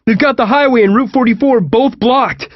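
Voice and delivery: loud, high-pitched urgent yell; screamed. A narrator's reading. John reporting blocked roads over loud music, screamed. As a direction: keep yelling the bad news over the music. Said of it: "John screamed over John Fogerty".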